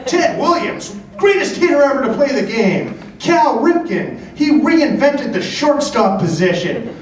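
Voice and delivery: high, emphatic explaining dad; imagined father. Retold or imitated. Father explaining baseball signatures to son, high incredulous dad impression. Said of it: "his father as he's trying to explain".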